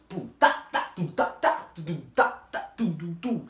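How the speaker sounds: mouth-made funk beat; beatbox the passing car's funk; imitated funk beat. Copying a car playing funk outside, mouthed beat. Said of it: "passa um carro tocando funk".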